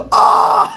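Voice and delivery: loud gasped "ah" of fans; mock crowd freak-out scream. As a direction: imitate fans losing it. Twelve people freaking out.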